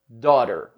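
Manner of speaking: "Daughter" modelled as DAW-rer, with flapped t; correct American pronunciation. Shown as a demonstration. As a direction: demonstrate "Daughter" with silent gh, American r. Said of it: "essa palavra se pronuncia daughter".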